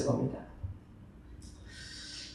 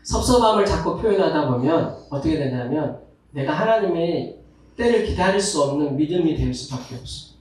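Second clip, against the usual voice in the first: ordinary delivery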